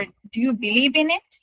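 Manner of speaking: yes-no question ending upward on "it"; rising question tune. Shown as a demonstration. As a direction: pitch climbing on "in it". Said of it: "এগুলো হবে rising".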